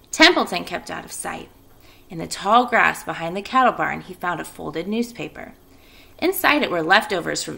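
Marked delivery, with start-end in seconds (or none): none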